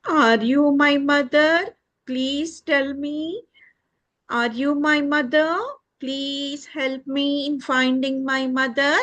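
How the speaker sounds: high begging chick voice; pleading chick. Acted. Chick asks the duck to help find its mother, high pleading. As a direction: plead as a little lost chick.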